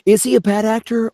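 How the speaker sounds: high, excited Nicolas Cage voice. Shown as a demonstration. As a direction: ask it like a manic Nicolas Cage. Repeats it in a put-on voice.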